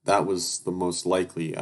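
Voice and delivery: usual speaking voice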